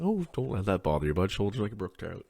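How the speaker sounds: lightly voiced easygoing buddy; a touch of the laid-back outback boy. Repeating the outback buddy's line, lightly voiced easygoing. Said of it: "outback boy".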